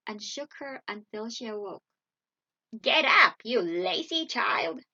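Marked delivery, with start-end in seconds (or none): 2.8-4.8 loud, bossy witch voice; witch's cry. A narrator's reading. Witch shakes Gretel awake, loud scolding cry. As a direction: cry it out harshly to rouse a sleeper. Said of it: "she cried".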